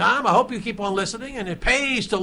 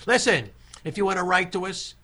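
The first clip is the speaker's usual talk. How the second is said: ordinary talk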